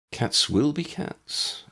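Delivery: slow, gentle reassurance; reassuring. Acted. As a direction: slowly soothe a sad child. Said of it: "Ambrose reassured her".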